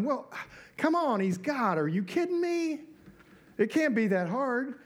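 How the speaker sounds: high-pitched, mock-incredulous protest; playfully exasperated, pitched well up; incredulous scoffing. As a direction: scoff as if the answer were obvious. Voices a dismissive believer's objection.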